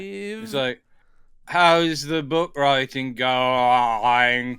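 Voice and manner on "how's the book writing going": put-on slow, flattened American accent; flat American accent. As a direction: flatten the vowels, American style. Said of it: "flatten that affect out".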